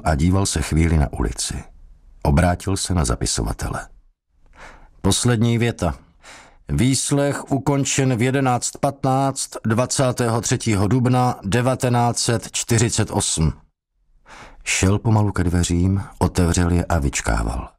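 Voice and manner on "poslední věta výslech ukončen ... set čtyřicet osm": higher, dry dictation to the clerk; lightly voiced interrogator dictating; official dictating. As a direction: close the record like a bored officer.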